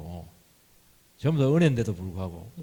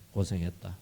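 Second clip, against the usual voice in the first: 고생했다 repeated much softer and gentler; softer comforting echo; 4 semitones lower, 6 dB quieter than usual. Retold or imitated. God holding Moses, repeating 고생했다 softly and tenderly. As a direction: let 고생했다 fall away into a tender hush. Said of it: "모세를 위로합니다".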